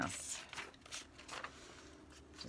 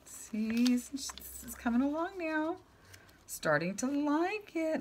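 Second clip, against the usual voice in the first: much higher, slow pleased sing-song; delighted; 13 semitones higher, slower than usual. Real, not acted. Crafter admires her painting progress, high delighted voice. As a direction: savor how good it looks.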